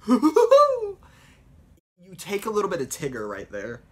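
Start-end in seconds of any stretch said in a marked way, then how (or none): 0.0-1.0 high loud slow manic laugh; Mad Hatter cackle. Retold or imitated. Mad Hatter laughing wildly at the tea party. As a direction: cackle like a madman. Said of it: "for the Mad Hatter".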